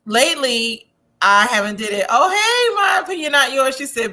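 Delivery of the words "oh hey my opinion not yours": high, pointed comment read; host reading a viewer comment aloud, high and sassy. Retold or imitated. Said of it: "reading a viewer comment".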